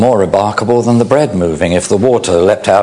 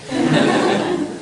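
soft laugh; 6 dB quieter than usual. Real, not acted. Preacher laughs at own joke.

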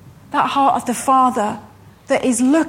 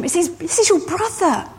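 high, pleading father's voice; the father pleading with his angry older son; 5 semitones higher than usual. Acted. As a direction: beg him gently as the father. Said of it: "Begged him".

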